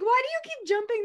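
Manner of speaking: mock the friends' confused asking; puzzled friends. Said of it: "they're like".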